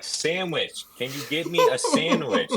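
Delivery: high mock voice through a laugh; laughing imitation of panhandler. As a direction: mimic the guy while cracking up. Retelling the man's request, laughing.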